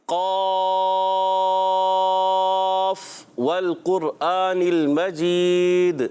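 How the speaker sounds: قاف held out long, then والقرآن المجید recited slowly; slow recited chant of قاف والقرآن المجید; drawn-out recitation. Shown as a demonstration. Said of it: "اس کو بھی پڑھتے ہیں".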